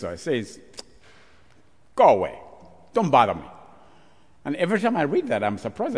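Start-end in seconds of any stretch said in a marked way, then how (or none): 2.0-3.4 dismissive. Acted. Preacher voices dismissive Jesus.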